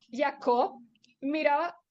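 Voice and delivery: plain reading voice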